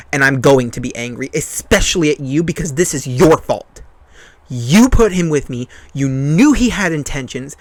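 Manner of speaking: slow, emphatic accusation; measured, forceful anger aimed at the one to blame; angry retelling. Retold or imitated. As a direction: hammer each phrase with rising indignation. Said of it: "I get a little angry".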